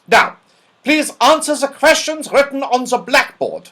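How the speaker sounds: put-on high, loud scolding old dame; Dame Snap, sharp. A narrator's reading. Stern old dame scolding a scruffy pupil, loud and high. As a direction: bark it like a cross schoolmistress. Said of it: "said Dame Snap".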